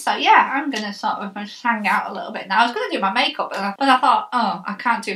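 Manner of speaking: jaw held up by strap; talking with strapped chin; wearing a chin strap. Real, not acted.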